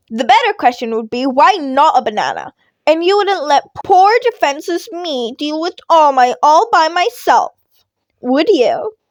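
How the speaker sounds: high, loud put-on helplessness; mock helpless. Midoriya plays helpless to his teacher, mock pleading. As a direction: play poor defenseless victim.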